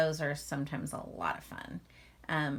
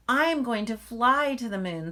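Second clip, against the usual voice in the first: higher and louder, insisting like a small cub; determined little bear; 6 semitones higher, 9 dB louder than usual. Acted. Little Bear insisting to Mother Bear, higher, louder and eager. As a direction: plant your feet and insist you'll fly. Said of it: "said Little Bear".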